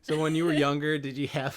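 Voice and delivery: ordinary talk, nothing marked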